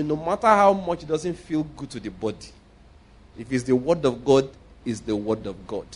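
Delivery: ordinary talk, nothing marked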